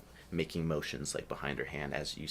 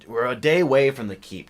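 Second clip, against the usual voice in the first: impatient scolding; 7 semitones higher, 14 dB louder than usual. Acted. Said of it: "I'm like".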